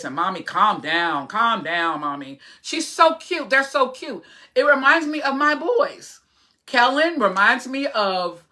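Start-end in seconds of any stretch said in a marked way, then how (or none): 0.2-2.4 flat, even imitation of a young girl; mild monotone, with little rise or fall; monotone daughter. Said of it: "she talks like this".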